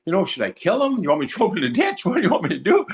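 high, pleading lament to God. Retold or imitated. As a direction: plead with the Lord in mounting distress. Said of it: "more upset".

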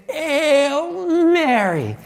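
high, very drawn-out strangled cry; strained breakthrough. Retold or imitated. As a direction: force the words out through a choked throat. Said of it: "finally was able to say".

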